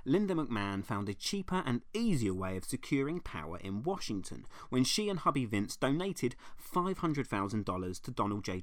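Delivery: ordinary talk, nothing marked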